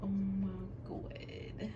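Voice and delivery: soft, drawn-out dread; scared. Real, not acted. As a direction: say it softly, really dreading what comes next. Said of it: "I'm so scared right now".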